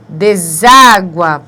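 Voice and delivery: "Deságua" slow and loud, stress on "á"; emphasized word. Shown as a demonstration. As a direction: drag out "Deságua" for the class. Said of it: "paroxítona terminada em ditongo". ("Deságua" lento e forte)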